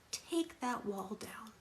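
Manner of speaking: ex-husband's slow mock whisper. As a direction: whisper it slowly like a coaxing ex. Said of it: "my ex-husband saying to me".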